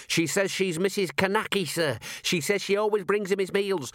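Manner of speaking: high-pitched, eager junior constable; Constable Visit. Acted. Constable reporting a gruesome find to his commander, high and eager. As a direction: report it high and keen to a superior.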